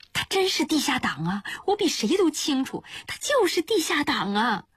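high-pitched, urgent insistence; insistent plea. A narrator's reading. Vouching for an accused friend, insistent and high-pitched.

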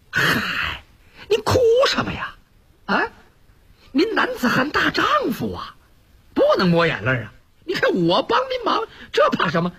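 put-on high, boyish teasing voice; a cheeky street lad coaxing his elder; young Yun Ge. Yun Ge comforting Wu Dalang, high boyish voice. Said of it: "他一瞅运哥又追上来了".

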